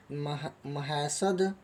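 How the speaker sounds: normal talking voice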